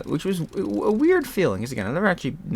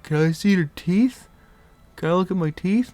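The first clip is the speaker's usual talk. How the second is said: loopy; 8 semitones higher, 3 dB louder than usual. Retold or imitated. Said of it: "hear about me being loopy".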